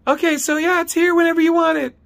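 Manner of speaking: loud, very high mock office lady; halting, high-pitched caricature; Dee's high-pitched voice. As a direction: mimic a grudging clerk in falsetto. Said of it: "she fucking goes".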